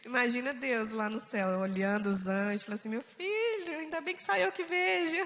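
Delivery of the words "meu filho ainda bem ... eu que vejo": high-pitched playful divine aside, laughing; God's amused remark. Pretend. God watching silly family dance from heaven, amused. God jokingly voiced to angels.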